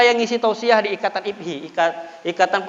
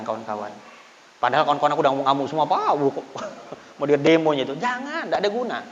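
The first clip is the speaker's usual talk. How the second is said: usual speaking voice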